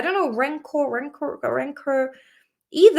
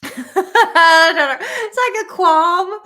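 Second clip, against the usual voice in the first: slow, high, louder words through laughter; laughing; 7 semitones higher, 8 dB louder, slower than usual. Real, not acted. Still cracking up at the looped word, laughing.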